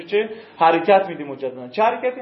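ordinary talk, nothing marked

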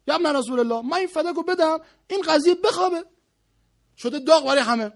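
high, loud acted voice of a fed-up ruler; exasperated caliph. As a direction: complain loudly as a ruler wanting the matter closed. Plays the exasperated caliph's message. (خلیفهٔ کلافه با صدای بلند و زیر)

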